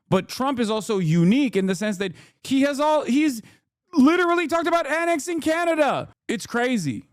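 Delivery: building from outrage to disbelief; incredulous outrage. Real, not acted. Outrage at annexing Canada.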